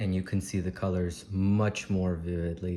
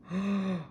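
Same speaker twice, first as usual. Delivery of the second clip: awed gasp; 11 semitones higher than usual. Real, not acted. Opening the fruit.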